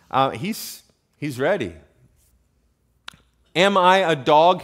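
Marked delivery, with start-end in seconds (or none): 3.5-4.6 loud indignant challenge; narrated roar, louder but held back; mild roar. A narrator's reading. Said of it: "he roared at David".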